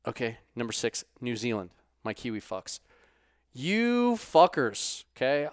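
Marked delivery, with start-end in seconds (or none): 3.5-5.5 very slow, high, loud drawn-out insult; mock scolding. Host teasing Kiwi fans, slow high mock scold. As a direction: stretch it out in playful outrage. Teasing New Zealand listeners.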